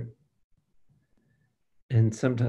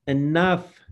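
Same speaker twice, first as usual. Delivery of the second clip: a higher, louder "Enough." thrown out sharply; commanding rebuke; 5 semitones higher, 4 dB louder than usual. Shown as a demonstration. Preacher shows what to tell your soul, a loud rebuke. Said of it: "Talk to your soul and say".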